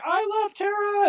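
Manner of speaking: high, slow swooning fan call; a lovestruck fan singing out her name, high and slow; adoring fan. Retold or imitated. Crowd fan with a sign cheering for Tara, high and slow. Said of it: "had made a whole sign and everything going".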